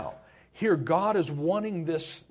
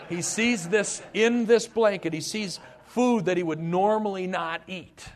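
excited shout; 7 semitones higher, 6 dB louder than usual. Real, not acted. Preacher builds Peter's vision.

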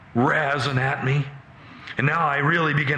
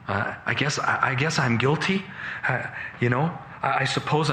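halting, with questioning rises; hesitant admission. Acted. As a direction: hedge your way reluctantly into admitting it. Son pleads hesitantly before father.

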